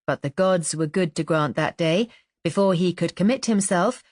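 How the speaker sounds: ordinary delivery, nothing marked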